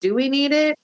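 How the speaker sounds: incredulous questioning. Retold or imitated. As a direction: ask it like you can't believe anyone cares this much. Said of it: "And I'm like".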